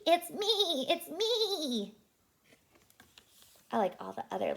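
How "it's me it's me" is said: high, lightly voiced sheep. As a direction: say it like a cheerful sheep. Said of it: "said Sheep".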